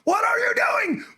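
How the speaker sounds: high, drawn-out exasperated yell; riled up. Retold or imitated. Coach to fighter not listening, riled-up yell. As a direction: imitate a coach yelling in disbelief. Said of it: "not that I don't get riled up".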